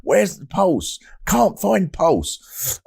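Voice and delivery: high-pitched mimicry of a baffled doctor; high, drawn-out retold doctor voice; imitated Chinese doctor. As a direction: draw out each phrase high, imitating the doctor. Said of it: "you imagine in his Chinese accent".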